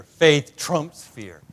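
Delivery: preached climax; loud, slow declaration. Real, not acted. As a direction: punch each word.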